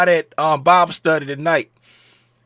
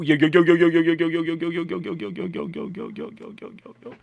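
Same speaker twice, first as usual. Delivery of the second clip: fading echo; 7 dB quieter, faster than usual. Pretend. Chanting yo, fading away.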